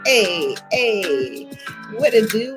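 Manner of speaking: ordinary talk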